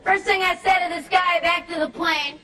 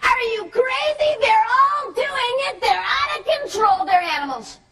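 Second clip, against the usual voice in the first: incredulous businessman; 7 semitones higher than usual. Retold or imitated. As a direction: blurt it out like he can't believe his ears. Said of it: "this guy looks over and he goes".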